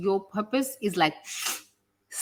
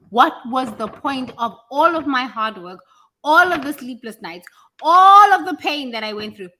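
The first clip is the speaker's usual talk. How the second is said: building anguished protest, higher and louder on each "all"; angry demand; 4 semitones higher, 8 dB louder than usual. Acted. Demanding God explain the hard work and pain, angry and building. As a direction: demand answers from God, swelling in pitch and volume.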